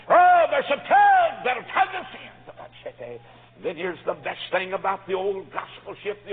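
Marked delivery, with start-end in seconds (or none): 0.1-2.3 high, loud revival shout; full-force preacher's shout; preaching shout. Real, not acted. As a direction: cry it out to the congregation.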